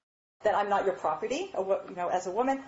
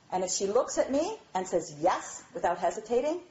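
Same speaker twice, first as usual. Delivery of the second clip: ordinary talk, nothing marked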